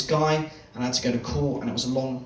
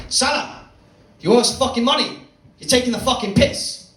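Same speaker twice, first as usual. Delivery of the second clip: loud, higher mock gangster threat; 8 semitones higher, 5 dB louder than usual. Gangsters threatening Salah through the wall, mock menacing yell. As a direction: bark it like a heavy threatening his debtor. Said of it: "what I could hear through the wall was like".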